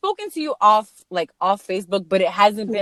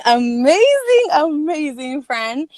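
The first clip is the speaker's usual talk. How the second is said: amazing, amazing stretched slow and rising; drawn-out emphasis; 4 semitones higher, slower than usual. Acted. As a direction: lean into each amazing slowly. Hyping up the guest.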